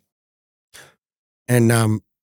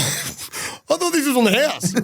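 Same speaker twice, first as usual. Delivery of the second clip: high, slow plea through laughter; stunned protest; 12 semitones higher, slower than usual. Retold or imitated. Customer shocked by the restaurant bill, stunned high protest. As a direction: retell it as a squeaky, drawn-out protest.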